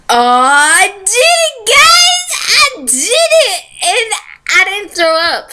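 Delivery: high, loud laughing shout of triumph; overjoyed, half laughing, half crying; excited. Real, not acted. Finishes burped alphabet, celebrates.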